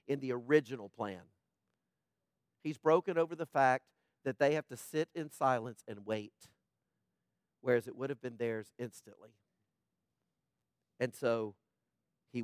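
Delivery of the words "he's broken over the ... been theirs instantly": ordinary delivery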